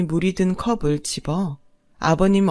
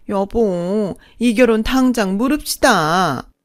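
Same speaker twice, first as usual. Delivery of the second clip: high, loud indignant whine; haughty second mother, outraged; 5 semitones higher, 6 dB louder than usual. Acted. Second mother demanding the wedding be cancelled, shrilly outraged. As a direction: wail in affront to your husband.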